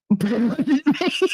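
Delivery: high-pitched, through laughter; laughing admission. Real, not acted. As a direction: crack up while confessing it. Laughs at her own admission.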